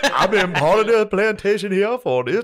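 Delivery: reenactor character. Retold or imitated. Retelling the reenactor's self-introduction, high and loud. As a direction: introduce yourself in a high, exaggerated voice. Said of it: "He's like".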